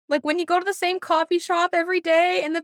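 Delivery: gushing, near tears with joy; overjoyed. Real, not acted. Friend gushing about regulars who know you, overjoyed and tearful. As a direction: let the joy spill over, voice breaking and calling out. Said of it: "It feels like it's gonna explode".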